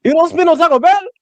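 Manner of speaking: put-on very high silly Spanish-accented voice; rapid-fire noes in high mock Spanish voice. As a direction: tease in a squealing fake Spanish accent. Keeps teasing in mock Spanish voice.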